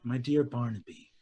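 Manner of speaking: soft, low tender royal voice; Queen of Dusk praises Barnaby, soft and tender. Acted. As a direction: speak it softly and gravely.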